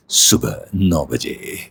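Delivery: slow, drawn-out reality show announcer voice; Bigg Boss narrator voice. Retold or imitated. Voice artist slips into his show announcer voice, slow and grand. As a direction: announce it like the show's daily narration, very slowly. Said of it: "तो यही बोलेंगे कि".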